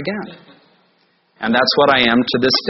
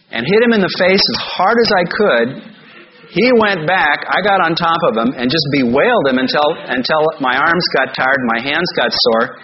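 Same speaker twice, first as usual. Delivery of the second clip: normal talking voice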